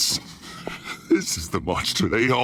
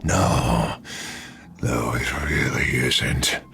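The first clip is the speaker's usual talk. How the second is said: sinister alter ego; 3 dB quieter, slower than usual. Acted. Alter ego picks victim, low.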